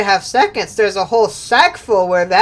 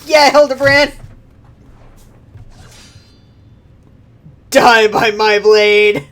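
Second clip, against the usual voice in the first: loud, slow heroic war cry; battle cry; 2 semitones higher, 7 dB louder than usual. Pretend. The player voicing a knight charging at the enemy, heroic war cry.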